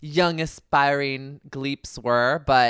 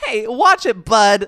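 high, loud mock warning; indignant bit player; 8 semitones higher, 8 dB louder, slower than usual. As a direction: overact a huffy extra telling someone off. Acting out indignant one-line bit parts.

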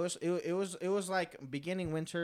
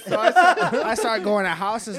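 laughing; 4 semitones higher, 8 dB louder than usual. Real, not acted. Laughing while retelling.

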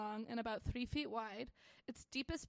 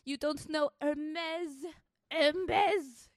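slow, hammed-up European scolding, building louder; mock Italian-French accent; 3 semitones higher, 6 dB louder, much slower than usual. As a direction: berate in a drawn-out fake French-turned-Italian accent. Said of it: "the way I turned Italian".

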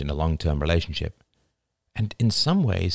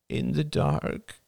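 put-on slow, soft little boy voice; small child; 6 semitones higher, 4 dB quieter, much slower than usual. A narrator's reading. Son answering his mother, slow and small. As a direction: mumble it like a sulky kid.

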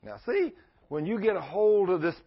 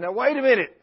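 surprised; 9 semitones higher, 8 dB louder than usual. Retold or imitated. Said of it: "He said".

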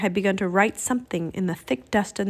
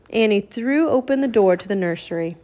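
plain reading voice

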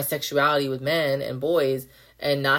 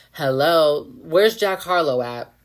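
ordinary talk, nothing marked

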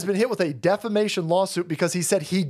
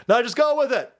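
high, insistent whisper-free push; urging handler; 8 semitones higher, 3 dB louder than usual. Acted. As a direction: cut in high, brushing off his doubts.

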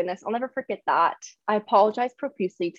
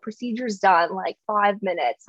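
ordinary delivery

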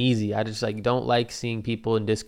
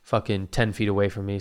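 ordinary delivery

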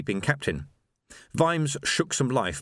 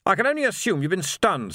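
high, loud officer's barked order; commanding captain; 9 semitones higher, 3 dB louder than usual. A narrator's reading. Captain orders the sergeant to fire over the barricade, loud and commanding. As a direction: command the squad crisply. Rust rebuking Vimes.

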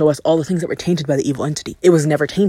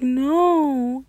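high, cute flat refusal; a pouty little no; mock-pouty no; Velma's no; 9 semitones higher than usual. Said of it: "But she's like".

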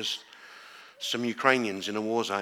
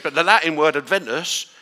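a touch of Essex accent; 4 semitones higher, 9 dB louder than usual. Real, not acted. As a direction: Adventus with an Essex twang. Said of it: "I sounded really Essex there".

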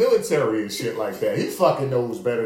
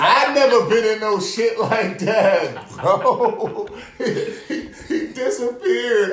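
slow, high, laughing disbelief; laughing, higher and louder; 8 semitones higher, 5 dB louder, slower than usual. Real, not acted. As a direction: laugh through each word.

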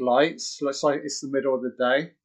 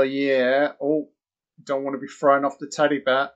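ordinary talk

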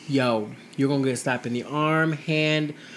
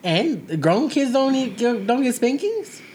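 incredulous drawl; 8 semitones higher, 4 dB louder, much slower than usual. Real, not acted. Questioning, drawn out.

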